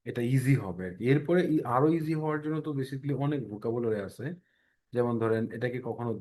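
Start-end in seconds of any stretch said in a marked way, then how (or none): none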